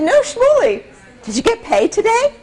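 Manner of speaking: a hint of a worried Yiddish mother. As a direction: press him like a wary mother. Mother voiced in story.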